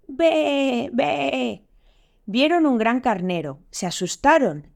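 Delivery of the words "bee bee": high, drawn-out sheep bleat; bleating ram. A narrator's reading. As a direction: imitate a ram bleating in long, high calls. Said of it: "sintieron una voz que decía". (balido de carnero, agudo y alargado)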